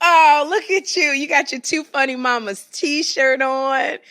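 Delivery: loud delighted exclamation; excited. Real, not acted. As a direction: gush over the shirt loudly. Excited reaction to t-shirt.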